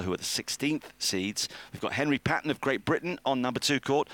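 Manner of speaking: ordinary talk, nothing marked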